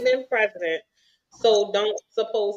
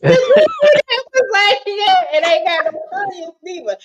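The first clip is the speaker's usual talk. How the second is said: laughing hard; 12 semitones higher, 4 dB louder than usual. Real, not acted. Host still laughing while quoting a viewer. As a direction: talk through the giggles. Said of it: "I'm cackling".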